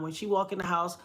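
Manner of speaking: normal talking voice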